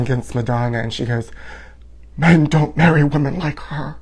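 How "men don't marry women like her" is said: soft breathy victim on the witness stand; a hushed hint of a wounded witness. Victim actress testifying against [name], quietly hushed. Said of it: "She always whispers".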